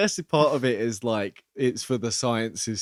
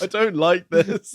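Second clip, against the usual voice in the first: annoyed; 8 semitones higher, 5 dB louder, slower than usual. Retold or imitated. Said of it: "getting annoyed at this kid. I was like".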